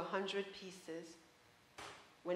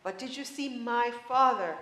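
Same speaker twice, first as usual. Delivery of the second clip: louder, higher, urgent demand; shouted; 4 semitones higher, 8 dB louder than usual. Acted. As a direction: press her hard for an answer. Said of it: "shouted the daughter".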